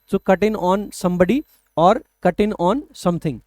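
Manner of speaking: slow, stretched-out phrase; teacher dictating slowly; slow dictation. Shown as a demonstration. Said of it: "states the phrasal verb".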